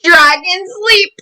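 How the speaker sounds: usual speaking voice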